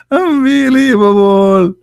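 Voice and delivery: high, drawn-out squeal; amused disbelief. Real, not acted. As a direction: squeeze it out slowly after laughing. Reacts laughing to the absurd claim.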